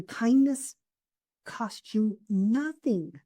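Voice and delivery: kindness stretched high, costs you nothing slowed right down; emphatic kindness. Real, not acted. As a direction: lean hard on kindness, then spell out costs you nothing slowly. Coach stressing her key message.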